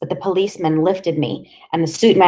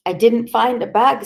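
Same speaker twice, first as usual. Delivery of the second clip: pleading, afraid; 6 semitones higher, 3 dB louder than usual. Acted. Boy repeats denial higher.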